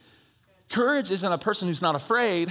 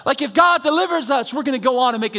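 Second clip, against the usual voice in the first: high, loud shouted preaching; full-force preaching; 10 semitones higher, 8 dB louder than usual. Real, not acted. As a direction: lift the church with a shout.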